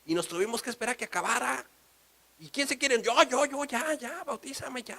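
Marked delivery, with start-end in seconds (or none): 2.4-5.0 high, eager calls; eager excited voices. Retold or imitated. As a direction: shout to be baptized right now, much higher. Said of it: "eager couple".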